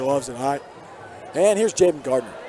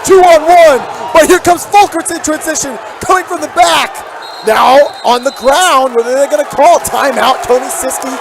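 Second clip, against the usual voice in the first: much higher and louder, near out of breath; breathless excited shout; 10 semitones higher, 11 dB louder than usual. Real, not acted. Commentator calling a frantic fast break, breathless shouting. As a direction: yell the fast break like you can barely keep up.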